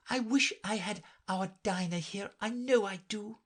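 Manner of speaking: put-on high, wishful girl's voice; Alice aloud. A narrator's reading. Alice addressing nobody in particular, high and wishful. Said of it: "said Alice aloud".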